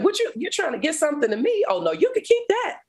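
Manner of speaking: high, disbelieving scoff at the offer; incredulous mock refusal. Woman to God in her cell, scoffing refusal. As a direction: pitch way up, brush it off like a joke.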